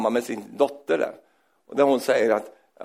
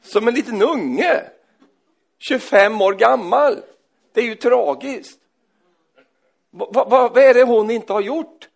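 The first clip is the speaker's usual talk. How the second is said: raised, higher preaching voice with chuckles; fiery preaching; 8 semitones higher, 7 dB louder than usual. Acted. Preacher scolding grown believers who stay childish, fiery preaching. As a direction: drive it home to the congregation.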